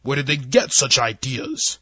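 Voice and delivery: slow demanding alien admiral. Acted. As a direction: press him for an answer slowly. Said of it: "the Admiral demanded".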